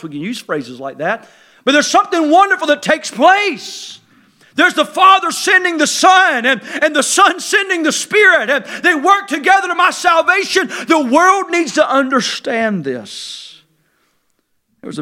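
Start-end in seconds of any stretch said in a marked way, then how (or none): 1.6-13.6 high, loud, shouted preaching; full-force preaching. Acted. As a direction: preach it out to the whole church.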